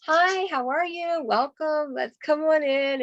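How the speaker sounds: high, slow, sugary welcome at the door; sweet hostess greeting. Retold or imitated. Consultant greets the arriving mom at the door, high and slow. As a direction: lay on the warm welcome for the guest.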